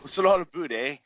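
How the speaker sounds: put-on playful Minnesota-Canadian accent; mock Canadian accent. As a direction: toss out a hammed-up Canadian "eh". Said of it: "Canadian accent".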